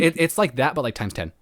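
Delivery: usual speaking voice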